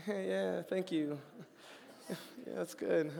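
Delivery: flat, let-down gratitude; unimpressed thanks. Retold or imitated. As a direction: mumble it like the gift is a letdown. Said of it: "a gift that you're like".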